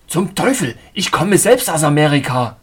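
loud, angry retort; exasperated outburst. A narrator's reading. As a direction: burst out with losing patience.

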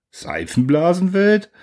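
high, slow, outraged question; drawn-out disbelieving repeat of Seifenblasenwelt; indignant echo. Acted. Council members repeat the insult in outrage, high and slow.

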